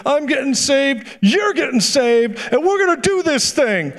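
high, drawn-out, emphatic imitation; rally a partner in a high, excited voice; slow, high-pitched mock exclamation; acted fired-up convert.

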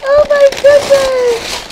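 high, loud thrilled squeal; delighted squeal. Real, not acted. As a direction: squeal it out with joy. Opening gift, delighted squeal.

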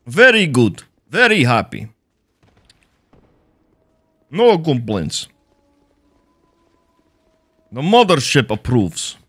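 put-on Russian accent while gaming. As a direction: play the comrade with a hammy Russian accent. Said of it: "Russian accent, comrade".

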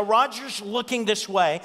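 ordinary talk

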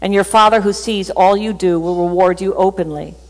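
usual speaking voice

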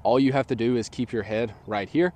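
ordinary talk, nothing marked

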